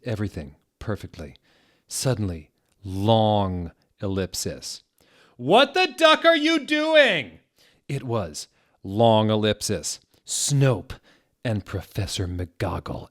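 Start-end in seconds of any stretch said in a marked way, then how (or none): 5.4-7.4 much higher, louder, slow shriek; hammed-up scandalised shriek; shrieked outrage. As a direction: scream it like you've caught them. Teachers burst in on them.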